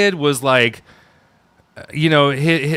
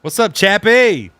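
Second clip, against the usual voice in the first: high, drawn-out put-on greeting; mock hailing; 6 semitones higher than usual. Cohost greeting Chappie in a bit, high drawn-out call. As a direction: call it out slowly, pitched up.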